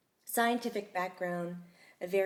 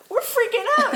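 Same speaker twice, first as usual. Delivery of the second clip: higher and louder panicky quote; mock freak-out; 11 dB louder than usual. Coworkers telling Darshana they're panicking, mock high and loud. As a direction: play the panic big and high. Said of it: "we're like".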